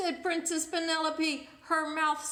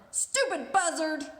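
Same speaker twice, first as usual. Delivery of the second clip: snarling insult; 2 semitones higher, 8 dB louder than usual. Acted.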